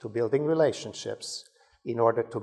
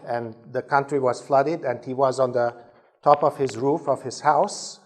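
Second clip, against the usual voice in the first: normal talking voice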